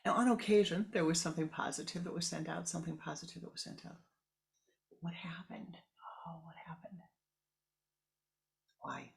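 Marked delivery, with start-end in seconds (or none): 5.0-9.1 hushed unvoiced question; whisper. A narrator's reading. Narrator whispers, wondering what went wrong.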